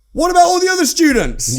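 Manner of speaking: high, loud indignant shout; retold shout. Shouting at his wife about a book, loud and indignant. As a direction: yell it at the book in outrage. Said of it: "I'm shouting at my wife".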